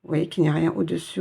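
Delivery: normal talking voice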